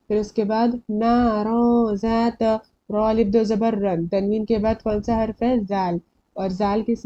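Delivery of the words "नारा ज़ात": नारा ज़ात slowly, end of नारा held nasally; ikhfa recitation. Shown as a demonstration. Teacher reading the next example, hidden noon. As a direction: hum through the nose before ज़ात in नारा ज़ात. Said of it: "इक्फा में से आता है".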